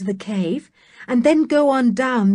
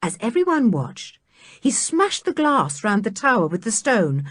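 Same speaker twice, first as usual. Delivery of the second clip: usual speaking voice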